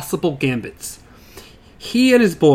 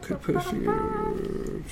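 high slow voice; 13 dB quieter, much slower than usual. Pretend. Odd high slow aside.

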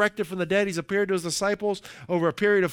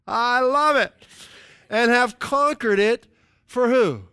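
raised, louder, driving preacher cadence; full-force preaching; 3 semitones higher, 4 dB louder than usual. Acted. Pastor urging the congregation to take courage, full-force preaching. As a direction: preach it hard, pushing each short command.

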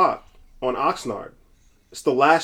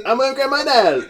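high, exaggerated mock Patois; fake Patois accent; 10 semitones higher than usual. Podcaster repeating the mocked line, in fake Patois accent. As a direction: imitate the rapper's put-on Patois. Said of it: "fake Patois accent".

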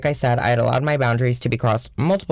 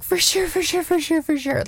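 very high, sing-song for sure; high ditzy agreement. Retold or imitated. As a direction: chirp it like a carefree airhead. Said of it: "And I was like".

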